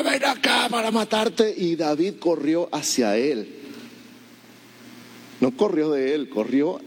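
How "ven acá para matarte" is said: high, slow roaring threat; Goliath's shout. Retold or imitated. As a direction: bellow at the boy. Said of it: "el filisteo gritó".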